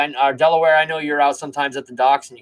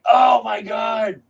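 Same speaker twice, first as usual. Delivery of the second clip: pained groan; 6 semitones higher, 3 dB louder, much slower than usual. Retold or imitated. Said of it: "and I was like".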